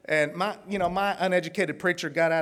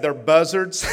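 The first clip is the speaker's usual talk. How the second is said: laughing; 6 dB louder, slower than usual. Retold or imitated. Punchline quoting the pastor.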